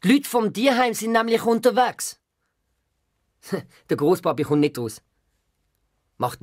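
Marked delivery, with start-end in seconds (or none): 0.0-2.2 high-pitched taunting call; raised, high storytelling call; calling after. A narrator's reading. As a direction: call it after someone who is leaving. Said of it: "ich rief dem Pudel nach".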